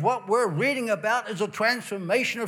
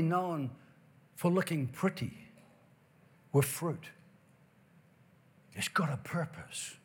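hushed; 4 semitones lower, 7 dB quieter than usual. Real, not acted. Preacher drops to hushed close.

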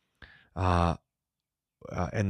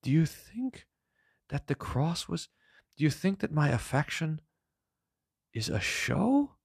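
higher, gently hurt and questioning; Jesus; 9 semitones higher than usual. Acted. Jesus asking if his love is a show, hurt and gentle. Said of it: "And J-, Jesus".

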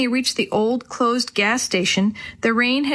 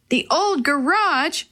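high, drawn-out exclamation; slow, raised-pitch outcry without extra volume; mild shout; 6 semitones higher, slower than usual. A narrator's reading. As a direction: exclaim it as a surprised question. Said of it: "Willie shouted".

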